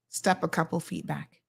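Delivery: low, soft, controlled warning; calm warning. Shown as a demonstration. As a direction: warn them quietly to back off. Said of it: "talk really slow and methodical".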